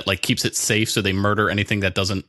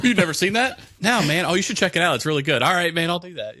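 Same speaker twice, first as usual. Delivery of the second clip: high-pitched mock chat between two pals; mock friendly exchange; 9 semitones higher than usual. Recommending an unseen movie to a friend, high mock voice. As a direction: play both sides in a high, put-on voice.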